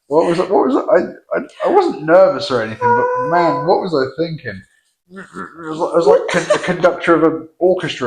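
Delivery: high-pitched, laughing at himself in disbelief; embarrassed disbelief. Real, not acted. Husband watching his own wedding speech, cringing in high-pitched disbelief. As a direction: squeak out the cringe of watching yourself.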